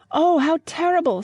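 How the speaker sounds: high, loud shocked cry; Lucy horrified at the wife's death, crying out loud and high. A narrator's reading. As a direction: gasp it out in horror. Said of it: "said Lucy".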